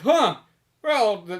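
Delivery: lightly caricatured rich bystander; rich onlookers. Acted. Rich bystander weighing a dying man against a suit, mock puzzlement. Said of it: "rich people are just like".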